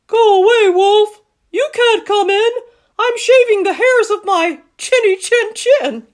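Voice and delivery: very high, loud squealing pig voice; yelling pig. Acted. Second pig refusing the wolf entry, high yell. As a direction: shout the wolf away in a high piggy voice. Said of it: "He yelled back".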